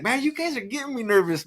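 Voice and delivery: high-pitched retold complaint; mock exasperation. Groom complaining to his groomsmen, in mock exasperation. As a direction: whine it playfully, pitch well up.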